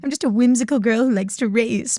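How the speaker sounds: mock-innocent girly self-parody; playful and goofy. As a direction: act the innocent whimsical girl. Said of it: "playful and goofy".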